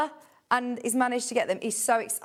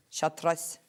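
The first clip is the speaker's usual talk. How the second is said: lower, slightly Scottish pronunciation; Scottish accent; 5 semitones lower, 3 dB quieter than usual. Real, not acted. Presenter names the green colourway, slight Scottish accent. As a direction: say it with a light Scottish lilt. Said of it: "I can't say chartreuse without".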